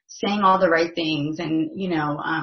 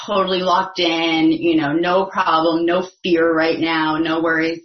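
mock confidence; 8 dB louder than usual. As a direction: hammer out the boast, not meaning it. Mimics a boast she then denies.

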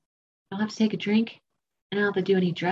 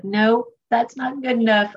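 higher, louder, slow mock refusal; balking; 4 semitones higher, 5 dB louder than usual. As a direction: dig in and balk, drawn out. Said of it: "I was balking".

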